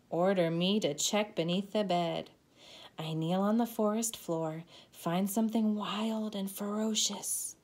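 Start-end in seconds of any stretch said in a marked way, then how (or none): none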